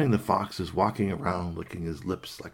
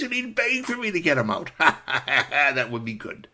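high gleeful fox laugh; gloating laughter; 6 semitones higher, 4 dB louder than usual. Acted. Fox delighted by rabbit's torment, laughing gloat. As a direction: break into a relishing laugh.

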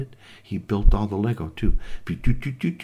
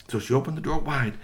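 ordinary delivery, nothing marked

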